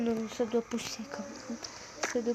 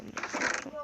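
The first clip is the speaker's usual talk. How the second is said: breathy secret whisper; 4 dB quieter than usual. Pretend. Telling viewers the same line, now whispered.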